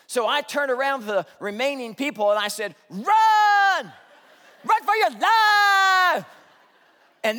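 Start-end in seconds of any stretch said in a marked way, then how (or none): 2.9-6.3 frantic shout. Retold or imitated. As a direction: yell it high and loud, stretching run for your life. Fleeing storm, yelling at crowd.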